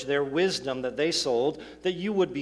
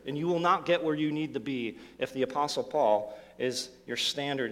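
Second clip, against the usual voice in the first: ordinary talk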